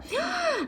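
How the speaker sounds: sharp high gasp of recognition. Acted. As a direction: gasp in shock. Stepsister recognizes Cinderella.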